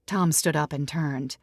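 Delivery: usual speaking voice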